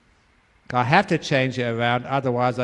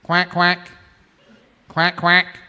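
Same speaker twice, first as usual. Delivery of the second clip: higher-pitched quack, lightly imitated; imitated duck call; 4 semitones higher, slower than usual. Storyteller imitates the sound heard in the gardens, light quacking. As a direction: mimic a quacking bird. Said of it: "they heard this sound".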